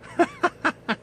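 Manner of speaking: high staccato cackle; derisive laugh. Real, not acted. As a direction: burst into a short, high laugh. Laughs at his own advice.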